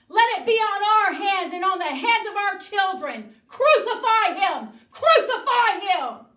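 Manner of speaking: high, full-force shouting; crowd yelling. Acted. The people answer Pilate washing his hands, yelling. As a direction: yell it as a frenzied mob. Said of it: "the people started yelling".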